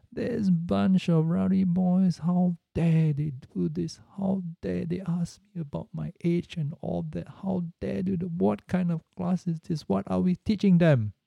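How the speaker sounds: a hint of the hurt, outraged teacher; mock outrage, how dare they; indignant complaining. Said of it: "she was complaining".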